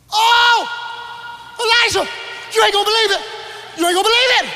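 high, loud, breathless excitement; can't contain the news; excited servant. Retold or imitated. Servant bursting in on Elisha, high excited shouting.

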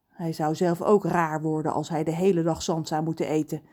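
plain reading voice

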